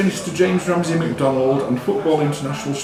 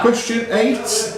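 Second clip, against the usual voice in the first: higher, slow, drawn-out question number; quiz question announcement; 9 semitones higher, slower than usual. Acted. Quizmaster to the contestants, slow rising announcement. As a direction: lift and stretch the number like a quizmaster.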